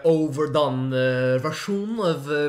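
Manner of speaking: hammed-up Norwegian voice; Norwegian accent. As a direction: stretch it out in mock Norwegian. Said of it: "some kind of Norwegian".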